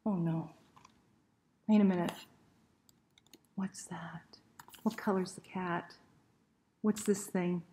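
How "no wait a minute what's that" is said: usual speaking voice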